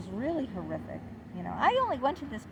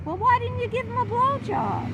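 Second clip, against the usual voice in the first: high, louder mocking of the therapist's question; incredulous mock quote; 12 semitones higher, 6 dB louder than usual. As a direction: throw his crude question back in mock disbelief. Therapist's crude question retold.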